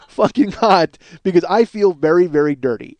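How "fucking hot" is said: laughing through it, stretched out; slow, laughing emphasis. Real, not acted. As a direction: crack up while stretching the words.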